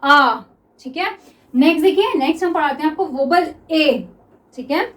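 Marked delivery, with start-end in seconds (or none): 0.0-0.4 आ held long and louder; teacher demonstrating the long आ vowel; long aa sound. Shown as a demonstration. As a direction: stretch out the vowel आ for students. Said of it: "लंबी सी एक आ की आवाज आती थी".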